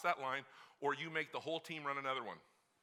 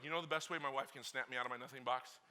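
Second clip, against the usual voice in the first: ordinary talk, nothing marked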